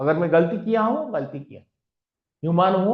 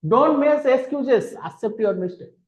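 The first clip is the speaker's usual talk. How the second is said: firm scolding; 6 semitones higher, 3 dB louder, much slower than usual. Acted. Scolding the excuse maker.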